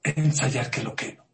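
ordinary delivery

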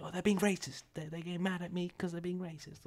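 imitating the friend's mock outrage at the police; mock indignation. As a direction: protest like he's being picked on. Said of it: "he's like".

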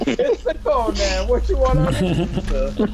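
mock Italian accent, hammy and broad. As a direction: play a cartoon Italian pizza guy. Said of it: "Oh, that's terrible".

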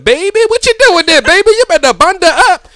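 high, loud scolding mother; fussing mama. Retold or imitated. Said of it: "she turned into my mama".

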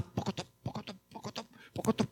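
hushed clip-clop of a horse; imitated hoofbeats. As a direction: patter out soft galloping hooves. Imitates horse hooves galloping. (som de cavalo galopando, baixinho)

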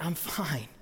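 low, quiet deadpan; understated reply. Retold or imitated. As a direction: answer the panic in a small, low voice. Understated reply to wife's scream.

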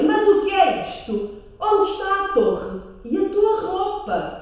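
high, sharp questioning; demanding prince. Acted. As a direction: demand answers indignantly. (príncipe exigente)

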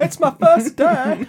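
high, slow, laughing rookie line; nervous eager uptalk. Retold or imitated. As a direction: play the nervous new recruit. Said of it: "the classic line".

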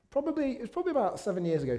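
usual speaking voice

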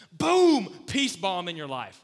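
loud, high boom, then a slow punch; preacher's loud emphatic boom. Real, not acted. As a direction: hit boom hard like an explosion. Sermon emphasis on sudden peace.

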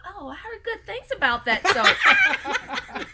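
high, slow, laughing sales-pitch voice; infomercial shopper. Retold or imitated. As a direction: plug it like a hammed-up infomercial customer. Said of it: "You started your own infomercial".

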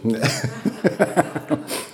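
quiet breathy chuckle; unvoiced little laugh trailing down; soft laugh. Real, not acted. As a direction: laugh off the joke. Laughs after offering foie gras.

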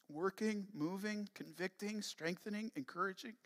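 soft, halting pastoral prayer; hushed, reverent prayer to the Lord; public prayer. Real, not acted. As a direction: pray quietly with long pauses. Leads congregation in prayer.

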